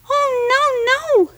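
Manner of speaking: very high, frightened little fly; high tiny fly voice. Acted. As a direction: squeak it like a scared tiny insect. Fly refuses.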